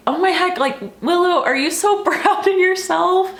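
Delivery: put-on high, bright-eyed praise to a child, laughing at the end; excited praise. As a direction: gush over her reading.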